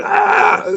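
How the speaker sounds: very high shrieking zombie cry. Retold or imitated. Imitating zombies rushing people, high shriek. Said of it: "That's when they get".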